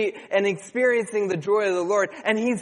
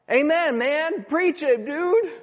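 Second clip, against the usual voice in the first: excited amen; 10 semitones higher, 3 dB louder, slower than usual. Retold or imitated. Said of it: "And I'm just like".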